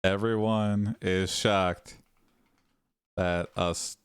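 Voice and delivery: low, halting narration with long gaps; slow, soft, word-by-word delivery; slow dictation. Real, not acted. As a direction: dictate it piece by piece as if typing. Dictates the story prompt while typing.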